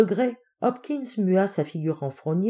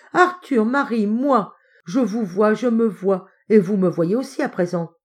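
loud, raised voice of discovery; overjoyed; 4 semitones higher, 5 dB louder than usual. Acted. Uncle seeing himself and his family again, overjoyed. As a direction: marvel at each person in turn.

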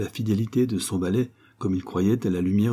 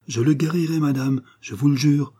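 emphatic oath, pitched higher; solemn, raised-pitch promise; solemn vow; 7 semitones higher, 4 dB louder than usual. A narrator's reading. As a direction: swear it earnestly to a lady. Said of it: "répondit solennellement le docteur".

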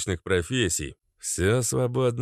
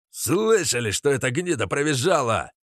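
loud, higher mocking yell; 6 semitones higher, 3 dB louder than usual. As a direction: roar it to the crowd. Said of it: "проорал Хейл".

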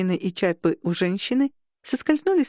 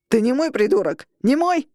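angry yell; 4 semitones higher, 4 dB louder than usual. Acted. Peachy yells at Rogan.

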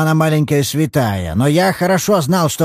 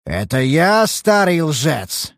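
slow, higher disbelieving "Это я старый лжец?"; outraged echo; 6 semitones higher, much slower than usual. Acted. Old father echoing his daughter's insult in outrage. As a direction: hurl it back slowly, stress on "я".

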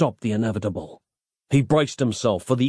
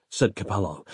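plain reading voice

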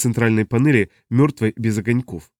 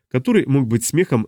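plain reading voice

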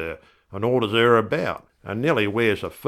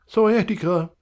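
"sciatica" high and pleased, like a verdict; doctor's diagnosis; 11 semitones higher than usual. Acted. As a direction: say "sciatica" pitched up, confident. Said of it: "he says".

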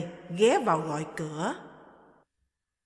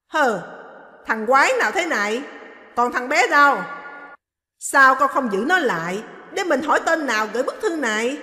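put-on loud, high indignant old lady; angry scolding; 4 semitones higher, 7 dB louder than usual. Mother-in-law angry over the letter, loud and high. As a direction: snap it out, offended and suspicious.

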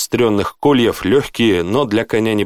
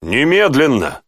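higher-pitched insistent command; barked order; 5 semitones higher than usual. A narrator's reading. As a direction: demand it at once, like an officer. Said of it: "рявкнул Тьерри".

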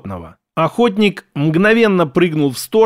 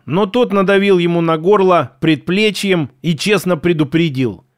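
ordinary delivery

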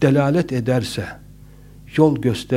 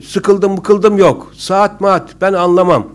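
higher, louder mock insistence; stubborn insistence; 5 semitones higher, 7 dB louder, slower than usual. Overrunning speaker to tired listeners, stubbornly insistent. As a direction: act out a speaker refusing to wrap up. Voices a speaker who won't stop.

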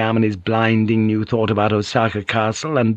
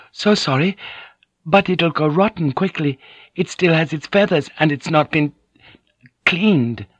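high, apologetic woman interpreter; flustered polite lady; 7 semitones higher than usual. A narrator's reading. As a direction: object politely in a high, troubled voice. Mariko apologises, still flustered.

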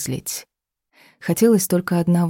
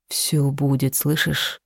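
hoarse whisper; 3 dB quieter, slower than usual. A narrator's reading. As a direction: breathe it hoarsely into her neck. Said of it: "Его хриплый шёпот".

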